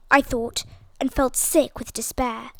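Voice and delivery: plain reading voice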